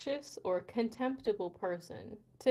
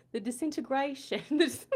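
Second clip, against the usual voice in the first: higher, mock British gone astray; unplaceable British accent; 5 semitones higher, 5 dB louder than usual. Trying a hard word on request, wayward British accent. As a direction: overdo a shaky attempt at British. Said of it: "What kind of accent?".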